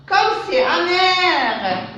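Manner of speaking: high, slow exclaiming voice; exclamation. Shown as a demonstration. Teacher models an exclamation, high and slow. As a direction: marvel at how bitter it is. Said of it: "c'est une phrase exclamative".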